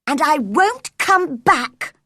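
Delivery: high, defiant shout; shouted retort. A narrator's reading. Mary flinging back a reply as she leaves, shouted. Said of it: "Mary shouted in reply".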